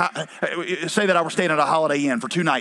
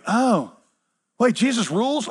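higher-pitched mock surprise; mock realization; 7 semitones higher than usual. Preacher mimicking a churchgoer hearing the basics for the first time, mock surprised. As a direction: act out a naive, dawning discovery. Mimics listener just discovering the obvious.

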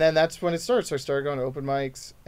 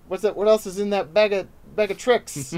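higher, louder showbiz type pressing the comic; mock demanding bookers; 6 semitones higher, 4 dB louder than usual. Bookers probe comic for other tricks, mock demanding. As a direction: play a pushy booker digging for extras. Mimics demanding industry people.

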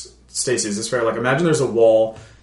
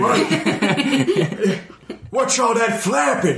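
high squeaky guard, laughing; a startled guard asking about the flapping; suspicious guard; 10 semitones higher than usual. Acted. Voicing a guard.

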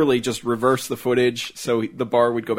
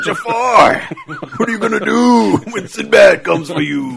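loud, high wrestling promo yell; 9 semitones higher, 8 dB louder than usual. Retold or imitated. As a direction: hype it like a ring promo. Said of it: "cutting a wrestling promo each time".

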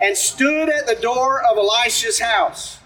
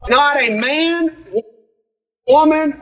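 rising preached emphasis; 4 semitones higher, 4 dB louder, slower than usual. Real, not acted. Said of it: "preaching emphasis".

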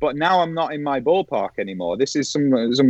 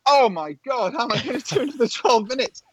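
high-pitched, hammed-up inner panic; retold panic; 10 semitones higher than usual. Presenter hearing twelve minutes remain, mock panic. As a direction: squeal it as a mock cry of despair. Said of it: "I'm panicking, massive panic".

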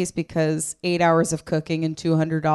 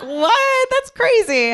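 very high, loud fake shock; mock astonishment; 6 dB louder, slower than usual. Comic feigning shock at a label, high mock astonishment. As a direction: gasp in fake disbelief at the label.

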